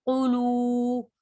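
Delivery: क़ुलू with the final oo held long and level; drawn-out vowel. Shown as a demonstration. Teacher shows the stretch caused by waw, drawn-out vowel. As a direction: hold the end of क़ुलू steadily. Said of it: "अभी वाव आ गया साथ".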